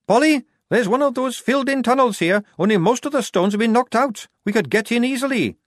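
a hint of a keen soldier girl calling down; lightly voiced girl soldier reporting a find; lightly voiced Tonka. A narrator's reading.

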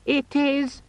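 very high, cloying squeak; squeaky, friendly demon. A narrator's reading. Pocket demon announcing the time, squeakily friendly. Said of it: "squeaky, friendly quality that begs to be strangled".

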